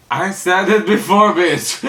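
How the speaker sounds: high-pitched comic comeback; squeaky mock outrage; mock indignant. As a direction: throw it back in exaggerated indignation. Snaps back at the crier.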